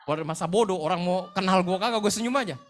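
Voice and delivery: loud, high comic outburst; hammed-up don't-care shout; comic defiance. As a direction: blurt it out loudly for laughs. Mock defiance in comic anecdote.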